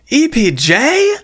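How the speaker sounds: high, drawn-out shocked question; incredulous team reaction; disbelief. Acted. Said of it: "says the whole team in disbelief".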